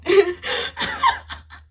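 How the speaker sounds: loud, high-pitched laugh; real, helpless burst of laughing; burst of laughter. Real, not acted. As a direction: crack up out loud mid-story. Cracks up over how mad Bob was.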